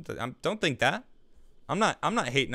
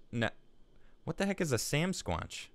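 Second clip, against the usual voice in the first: usual speaking voice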